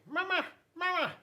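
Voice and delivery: very high, chirpy gosling calling Mama; baby goose voice. Acted. Goslings calling after grumpy bear Bruce, high and chirpy. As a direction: pipe it up like a tiny gosling calling its mother. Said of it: "the geese kept saying".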